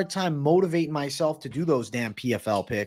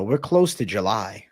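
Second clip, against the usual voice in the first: ordinary delivery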